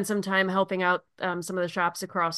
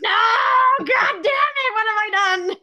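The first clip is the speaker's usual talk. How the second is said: high, loud melodramatic cry of despair; mock anguished wail; 14 semitones higher, 7 dB louder than usual. Co-host dreading the twang all episode, mock wail. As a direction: wail it out in hammed-up horror.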